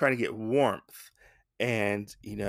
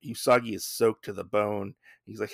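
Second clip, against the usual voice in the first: ordinary talk, nothing marked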